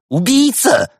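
very high, loud squeal; shriek. Acted. Businessman turns on the doctor, shrieking. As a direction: shriek the accusation. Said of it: "взвизгнул делец".

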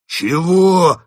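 high, loud outraged roar. A narrator's reading. First wolf outraged by the claim, roaring. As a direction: bellow it in outrage. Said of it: "взревел первый волк".

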